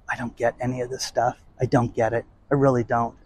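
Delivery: soft, resigned confession; quiet resignation. Real, not acted. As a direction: drop to a defeated murmur.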